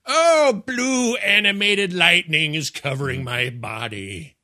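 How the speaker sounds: mock Luke moaning, high then slow and drawn out; hammed-up agony. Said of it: "Luke on the ground writhing around going".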